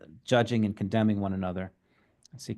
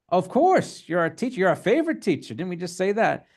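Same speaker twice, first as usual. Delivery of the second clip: mock eager students; 7 semitones higher than usual. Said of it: "And I'm like".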